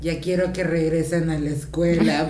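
laughing mock fed-up mom; fed-up confession. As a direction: blurt out the honest exhaustion. Imitating a mom admitting exhaustion.

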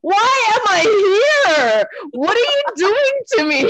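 high, whiny fake cry; mock whimper. Texting her mentor from the seminar, high fake whimper. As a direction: whine like you're being tortured.

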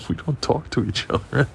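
ordinary talk

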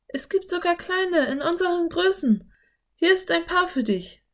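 put-on girl voice, keen to go in; eager Anne. A narrator's reading. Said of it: "drückte sie Philipp ein Paar".

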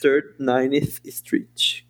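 Third, Ninth Street drawn out very slowly; slow English demo. Shown as a demonstration.

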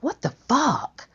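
high, stunned disbelief; incredulous. Retold or imitated. Teller reliving her disbelief at the ley line story, incredulous and high.

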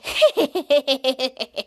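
long run of staccato giggles; imitated villain laugh. The toddler villain enjoying the scene, long staccato acted laugh. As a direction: cackle in character, falling away. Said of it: "And Tyler said".

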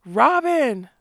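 high, loud call into the woods; the outlaws shout after their hero. A narrator's reading. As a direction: yell the name after them. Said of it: "they shouted".

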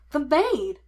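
high, loud cry of discovery; shouted. A narrator's reading. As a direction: shout out the answer. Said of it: "Sean shouted".

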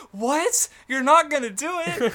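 high, loud, incredulous outburst; mock outrage. Oars reacting to the crew's refusal, loud mock outrage. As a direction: voice jumps up in hammed-up disbelief.

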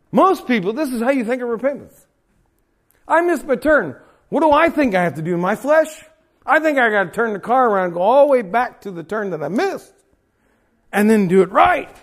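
loud, high, impassioned preacher's climax; full-force preaching. Acted. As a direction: preach it hard to the congregation.

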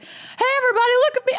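put-on high, nervous party kid; a touch of an awkward kid's voice; weird voice. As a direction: squeak it out like a scared kid showing off. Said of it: "I'm gonna do a weird voice".

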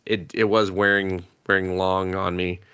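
ordinary delivery

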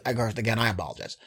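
quiet aside. Real, not acted. Quick apology after cursing again.

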